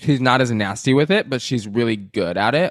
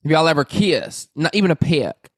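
put-on Southern accent; 5 semitones higher than usual. Friend pressing for gossip in a high Southern accent. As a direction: press her like a nosy Southern belle. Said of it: "You have a good Southern accent".

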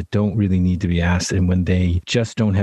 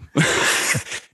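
breathy laugh; 8 semitones higher than usual. Real, not acted. Laughs at wife joke.

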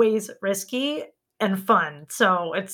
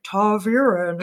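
a clumsy try at a giant's voice, odd timbre; bad Loial voice; slower than usual. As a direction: fumble a Loial imitation on one word. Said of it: "I tried to do it in the primer".

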